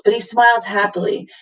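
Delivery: usual speaking voice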